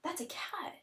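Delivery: high, breathless whispered wonder at the cat; excited whisper. Shown as a demonstration. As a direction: whisper it like an amazing discovery. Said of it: "it could be like".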